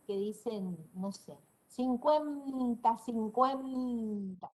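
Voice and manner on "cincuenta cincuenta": cincuenta, cincuenta estirado muy lento como locutor de radio; locutor alargado. Shown as a demonstration. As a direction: alarga cincuenta sílaba por sílaba. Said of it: "no dicen". (cincuenta muy lento, estilo locutor)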